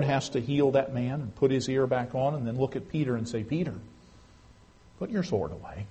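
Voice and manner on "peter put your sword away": softer, lower gentle correction; calm rebuke. Retold or imitated. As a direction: settle him down quietly. Jesus calmly rebukes Peter.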